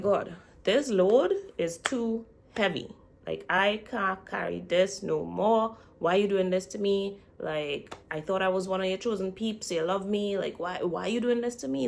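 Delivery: loud, exasperated mock plea; complaining Moses. Moses griping to God about his burden, exasperated plea. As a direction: plead loudly and wearily, stretching the heavy words. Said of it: "Moses basically went back and was like".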